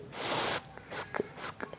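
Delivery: "sk" hissed softly with no voice; whispered sk sound. Shown as a demonstration. Teacher isolating the "sk" sound for students. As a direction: breathe out "sk" without voice.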